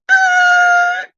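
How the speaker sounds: loud, very high sustained "ah" like a boiling kettle; teapot whistle. Acted. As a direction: screech like a teapot coming to the boil. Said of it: "teapots, when it get hot enough, it go".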